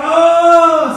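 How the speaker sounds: high, loud whoop of vindication; hammed-up gloating cry; acted triumph. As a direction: crow loudly at winning the argument. Acted triumph over wife.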